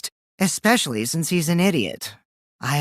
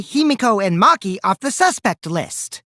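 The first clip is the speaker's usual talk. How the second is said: ordinary talk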